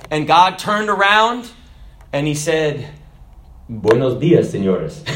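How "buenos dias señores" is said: lightly Spanish, low and drawn greeting; God greeting the two men in Spanish. Acted. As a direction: deliver the punchline as a Spanish greeting. God turns around, punchline.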